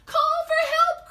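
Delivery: shouting in panic; panicked cry. Acted. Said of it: "cried B B".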